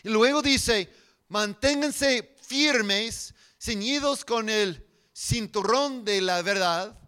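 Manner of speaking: loud, high, forceful scripture preaching; prédica a plena voz. Acted. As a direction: proclaim the verses to rally the congregation. (proclamando la Escritura con fuerza)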